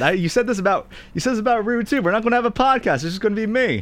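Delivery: high-pitched indignant protest; mock outrage. Real, not acted. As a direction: squeal it in playful outrage.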